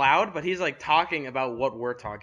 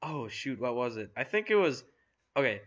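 ordinary delivery

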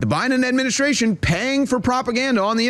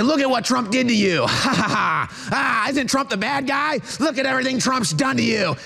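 cartoon villain with a forced laugh; hammed-up taunting attacker; gloating mock accuser; 3 semitones higher than usual. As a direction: gloat and blame in a raised put-on voice. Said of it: "They say".

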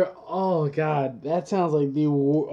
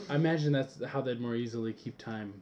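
ordinary talk, nothing marked

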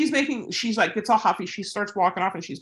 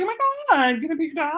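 much higher, squealing with delight; gushing stranger; 9 semitones higher, much faster than usual. Retold or imitated. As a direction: play the over-excited dog lover rushing up. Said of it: "somebody comes up and they're like".

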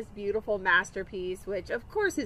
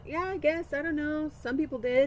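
lightly voiced son; 6 semitones higher than usual. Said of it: "He's all".

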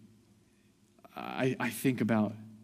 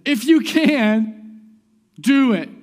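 loud, high, drawn-out mimicry of a father pleading; imitated plea; 13 semitones higher, 14 dB louder, much slower than usual. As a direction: call it out slowly, ending firmly on "do it". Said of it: "the guy's literally just said".

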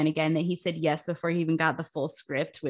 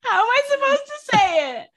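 high, laughing protest at teasing; mock-hurt protest; 10 semitones higher, 5 dB louder than usual. Real, not acted. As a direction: squeal in playful indignation. Mock-hurt protest at being teased.

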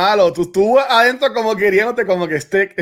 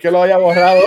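ordinary talk, nothing marked

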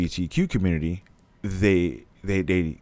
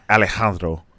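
Alejandro in a Spanish accent, a bit louder; demonstrated Spanish-accented name; 5 dB louder than usual. Shown as a demonstration. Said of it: "it's the Spanish accent on A".

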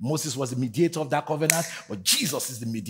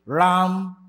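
preaching; 4 semitones higher, 5 dB louder than usual. Real, not acted. Sung preaching cadence.

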